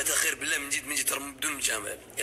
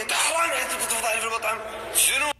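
loud, high playful accusing; hammed-up telling off a friend; mock accusation; 7 semitones higher, 5 dB louder, faster than usual. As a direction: shout in mock outrage at a friend.

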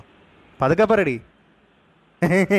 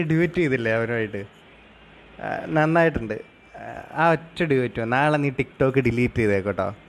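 mock calm; 3 semitones lower, 6 dB quieter than usual. Possessive boyfriend feigning calm freedom.

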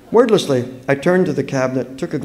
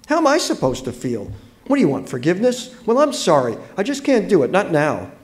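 higher, rising indignant protest; upset outburst; 7 semitones higher, 3 dB louder than usual. A narrator's reading. Allison rebuffing the girl's apology, upset and indignant. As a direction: refuse the apology, quick and higher. Owner snaps at apologizing girl.

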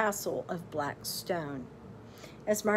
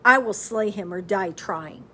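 vow; 8 dB louder than usual. A narrator's reading. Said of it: "vowed Simon".

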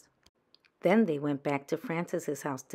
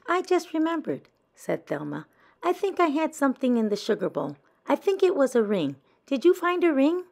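put-on high, louder, quick worried child; eager Thelma; 10 semitones higher, 6 dB louder than usual. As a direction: rush it out, fishing for the ring.

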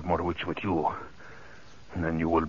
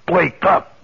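much higher, much louder yell; furious shout; 12 semitones higher, 11 dB louder than usual. Acted. Killer screaming at drowsy Ellen to wake up, furious shout. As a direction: bellow it to jolt her awake. Villain yells at captive.

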